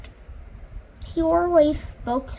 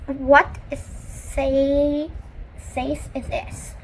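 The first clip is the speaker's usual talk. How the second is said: ordinary delivery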